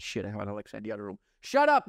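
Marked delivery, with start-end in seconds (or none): 1.4-1.9 sharp, loud and high shout at the device; sudden shout. Real, not acted. Shouting at the Alexa in the other room, loud and high. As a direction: snap it out to silence the speaker.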